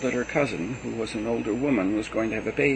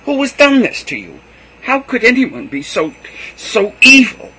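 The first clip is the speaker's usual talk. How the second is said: much higher and much louder, shouting; betrayed man yelling; furious scream; 12 semitones higher, 13 dB louder than usual. A narrator's reading. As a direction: scream it in outrage. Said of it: "I fairly screamed".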